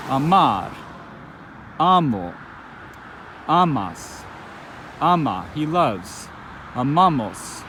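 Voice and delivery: "Amar" stressed at the end, "amo" and "amas" on the first syllable; teacher reciting the forms with clear stress; "amamos" stressed on MA, "Aman" on A; conjugation drill. Shown as a demonstration. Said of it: "let's do some examples".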